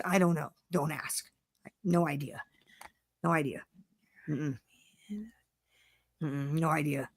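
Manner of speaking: soft, secretive no idea; hushed denial. Acted. Telling the co-host not to ask, hushed and secretive. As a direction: whisper it like you're hiding something. Deflecting where he finds people.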